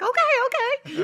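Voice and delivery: put-on very high, loud childlike okay; kids answering. First graders answering their excited teacher, high kid voice. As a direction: play the eager kids agreeing. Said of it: "And they're like".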